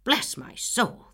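loud slow bless my soul; outraged exclamation. Acted. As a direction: drag out the outrage. Angry neighbor exclaims.

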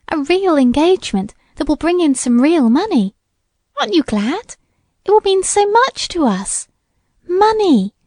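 put-on eager, high-pitched girlish urging; excited. A narrator's reading. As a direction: bubble over about the good news. Excited sister urging her to rejoice.

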